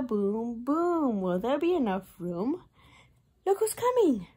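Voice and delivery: ordinary talk, nothing marked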